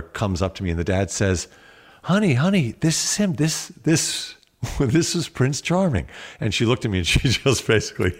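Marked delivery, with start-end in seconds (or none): 2.0-6.1 high, coaxing dad voice presenting the prince; imitating the dad. Dad showing his daughter her hero, coaxing. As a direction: sweetly mimic an excited father.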